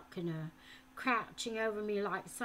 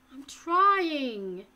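higher, louder pleading; desperate plea; 5 semitones higher, 11 dB louder than usual. A narrator's reading. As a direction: beg to be believed. Boy pleads.

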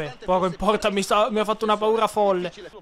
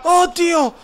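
startled gasp; 8 semitones higher, 7 dB louder than usual. Real, not acted. Near miss, fright.

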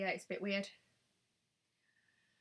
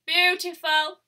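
loud, higher Beautiful! with a rising tune; excited exclaim; 7 semitones higher, 14 dB louder, slower than usual. Real, not acted. Admiring her makeup on camera, a loud delighted Beautiful!. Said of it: "oh, beautiful".

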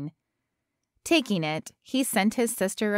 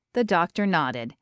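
ordinary delivery

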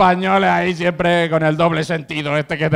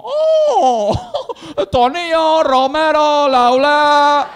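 loud, high-pitched mock Chinese accent; hammed-up Chinese-accented exclamation; mock Chinese shopkeeper accent; 8 semitones higher, 4 dB louder, much slower than usual. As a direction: call it out as a caricatured Chinese shopkeeper. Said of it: "me dice el chino".

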